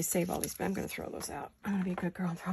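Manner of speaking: breathy whisper under her breath; whispered aside. Real, not acted. Crafter muttering to herself, whispering as she discards paper. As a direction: whisper it to yourself while tossing scraps.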